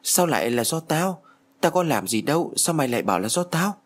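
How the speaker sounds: sharp, high, startled questions; stunned girl protesting to her ghost friend; shocked. A narrator's reading. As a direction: blurt it out in disbelief. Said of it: "Em sửng sốt nói".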